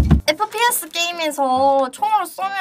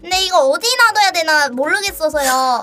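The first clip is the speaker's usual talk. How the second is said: voice-changed phone call; loud squeaky helium voice; 2 semitones higher, 5 dB louder than usual. Real, not acted. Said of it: "진짜 변조 잘했다".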